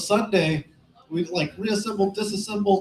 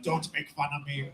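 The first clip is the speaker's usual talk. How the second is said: quiet ghost voice; 5 semitones lower, 10 dB quieter than usual. Retold or imitated. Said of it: "be like".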